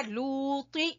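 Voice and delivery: लूती drawn out, with the t said full and heavy; recited slowly. Shown as a demonstration. Teacher introducing the next example, slow recitation. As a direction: read लूती slowly and heavily. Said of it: "आगे है".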